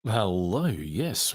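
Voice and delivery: ordinary talk, nothing marked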